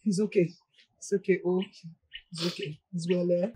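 calm her gently; soothing. Acted. Mother soothing daughter.

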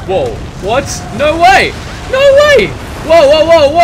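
very high, loud astonished yell; shocked shout. Real, not acted. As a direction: scream it in total shock. Avalanche suddenly hits.

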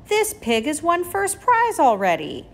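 alarmed cry. A narrator's reading. Avery upset at the prize.

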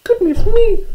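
very high, squeaky tiny mouse voice; little mouse. Acted. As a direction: exclaim in a loud mouse squeak. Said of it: "said Little Mouse".

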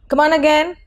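ordinary delivery

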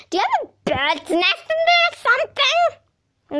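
high, nasty witch voice. Acted. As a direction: sneer it like a cackling witch. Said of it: "sounded like a witch".

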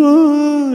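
loud, high mock wail; hammed-up bawling cry; imitated weeping. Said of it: "I'm weeping".

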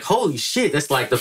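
high, stunned gasp of awe; starstruck holy shit. Retold or imitated. Fan meeting his hero, high starstruck squeal.

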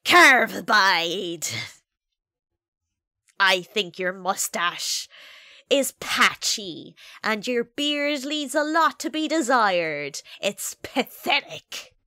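loud, slow mock insult; mock harsh roast. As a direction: deliver the insult slowly with fake menace. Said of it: "this is gonna be harsh".

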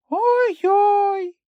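very high, frightened cry; scared yelp. A narrator's reading. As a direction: jump at the noise. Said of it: "налякано зойкнула Хробся". (переляканий зойк)

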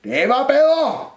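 slow, high cupboard holler; shouted approval. A narrator's reading. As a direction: holler back with drawn-out words. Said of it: "råbte skabet". (råber langsomt)

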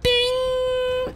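high, held ringing note; imitated bell ding. Streamer voicing the real box opening, high ding. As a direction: sound the bell with your voice.

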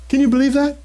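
shocked disbelief. Real, not acted. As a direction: throw the question out in disbelief. Outraged at being called Satan.

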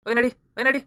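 loud, high shove-along command; barking order. Acted. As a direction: bark at him to move. Constable drags prisoner away.

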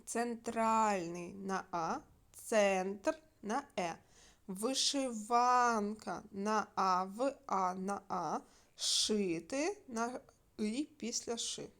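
slow, spelled-out stress answers; teacher spelling out where the stress falls; slow stress dictation. Shown as a demonstration. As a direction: dictate each stress slowly for checking. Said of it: "давайте звіримося".

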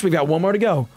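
ordinary delivery

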